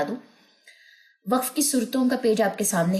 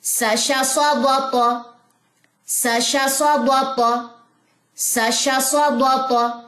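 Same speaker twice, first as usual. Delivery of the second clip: slow, sing-song syllables; rhythmic reading; 6 semitones higher, 4 dB louder, slower than usual. Shown as a demonstration. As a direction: read it in one steady rhythm. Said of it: "practice ज़्यादा करनी पड़ेगी".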